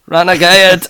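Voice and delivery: loud dramatic take on the name; booming shout. Pretend. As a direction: boom it out big. Repeats beer name louder.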